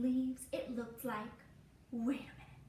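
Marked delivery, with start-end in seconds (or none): none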